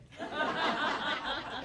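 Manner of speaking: a sudden high laugh bursts out; high burst of laughter. Real, not acted. Telling the class about his father, a high laugh.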